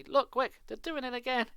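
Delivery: high, loud, thrilled yell; excited shout. Acted. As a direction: call her back to see it now. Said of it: "shouted excitedly".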